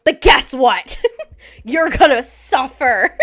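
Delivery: loud punchline through laughter; loud, slow, joking doom; gleeful mock warning. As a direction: draw out the bad news, laughing.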